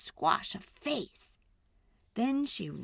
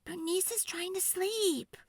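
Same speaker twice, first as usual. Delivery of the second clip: put-on much higher, soft child voice; a child protecting her sleeping squash; little girl voice; 11 semitones higher, 3 dB quieter than usual. A narrator's reading. Girl shushing parents so squash sleeps, high and soft. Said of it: "Sophie said".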